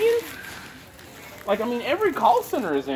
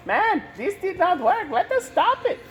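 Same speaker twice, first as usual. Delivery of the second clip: mock official; 11 semitones higher, slower than usual.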